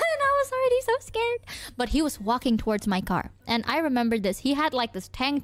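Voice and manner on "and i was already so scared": high, quick words through a laugh; real laughter spilling into the line. Real, not acted. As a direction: squeeze it out fast and high, amused. Laughing over own scare.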